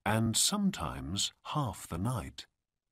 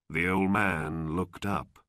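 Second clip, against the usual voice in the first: ordinary delivery, nothing marked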